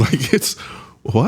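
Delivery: laugh, then stammer in real disbelief. Real, not acted. Interviewer baffled by the ridiculous license, laughing in disbelief. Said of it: "I'm laughing because it's so ridiculous".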